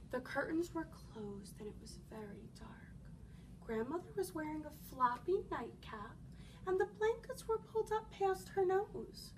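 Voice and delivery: ordinary delivery, nothing marked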